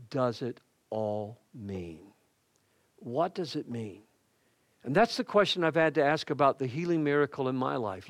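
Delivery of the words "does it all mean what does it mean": hushed and solemn. Real, not acted. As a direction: drop to a low, soft voice. Solemn question to congregation.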